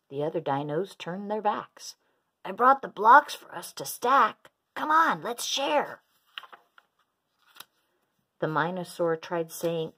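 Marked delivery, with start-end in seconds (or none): 2.4-6.0 high, loud coaxing, slowing at the end; eager coaxing. A narrator's reading. Minosaur asking the dinos to play, coaxing. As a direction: coax them in a raised, eager voice.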